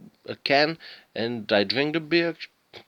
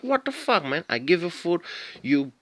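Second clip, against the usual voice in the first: shocked outrage; 7 semitones higher than usual. Retold or imitated. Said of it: "I was like".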